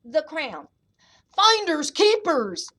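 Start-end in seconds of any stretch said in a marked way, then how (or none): 1.3-2.7 loud, drawn-out boast, pitched up; big slow storybook bear yell. A narrator's reading. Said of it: "yelled the bear".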